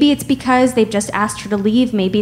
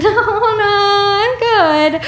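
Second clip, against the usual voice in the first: laughing; 11 semitones higher, slower than usual. Retold or imitated. Nervous laughing reply.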